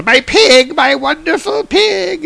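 very high, put-on wailing cry; distraught wine god. Dionysus seeing his pig chased, high hammed-up cry. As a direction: cry out high, stretching the last words.

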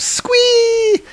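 tiny squeal, very high and thin; rat's surprised squeak. Retold or imitated. Startled rat squeaking at a frog, high squeal. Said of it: "Rat is very surprised. He goes".

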